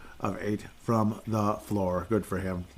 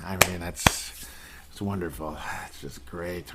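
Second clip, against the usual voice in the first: dry sarcastic, trailing softer; 4 semitones lower, 5 dB quieter than usual. Real, not acted. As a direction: say it's wonderful, meaning the opposite. Sarcastic about the loss.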